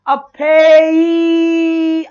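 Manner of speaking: फे ई held long, syllable by syllable; slow syllable demo. Shown as a demonstration. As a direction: stretch फे ई apart. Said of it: "अब आएगा ई".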